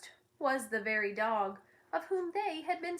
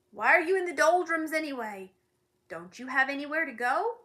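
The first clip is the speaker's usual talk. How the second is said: gruff scolding; 4 semitones higher, 7 dB louder than usual. Acted. Watchdog scolds Milo.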